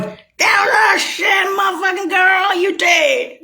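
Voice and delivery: high, shouted jeer with a cry in it; imitating an angry shouting crowd; mock Russian shout. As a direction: yell it high as if accusing her. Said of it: "Or the Russians going".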